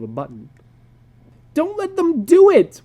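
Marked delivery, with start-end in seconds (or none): 1.5-2.8 put-on high, loud wailing boy. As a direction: wail up at the ceiling. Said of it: "wailed Peter".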